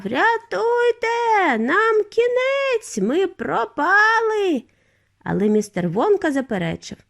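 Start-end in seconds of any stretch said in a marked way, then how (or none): 0.0-4.6 high, long-drawn wailing; despairing high cries; terrified cry for help. A narrator's reading. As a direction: wail in panic, stretching each word. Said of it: "дідунь Джо закричав".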